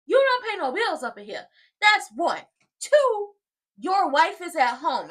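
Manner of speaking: loud, high-pitched telling-off; acted scolding. Scolding a man who barged into the house, acted outrage. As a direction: lay into him with mock outrage.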